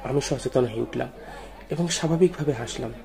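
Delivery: usual speaking voice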